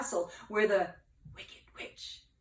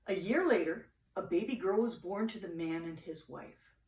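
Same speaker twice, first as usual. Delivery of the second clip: ordinary delivery, nothing marked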